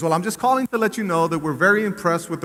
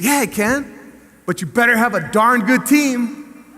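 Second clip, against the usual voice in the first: building emphasis; 6 semitones higher, 3 dB louder than usual. Retold or imitated. Emphatic warning to the commissioner.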